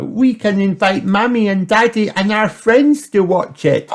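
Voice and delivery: put-on high, loud child voice; excited Peppa. A narrator's reading. Peppa plans the show, high excited voice. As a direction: plan it out loud, pitched right up. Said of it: "cried Peppa".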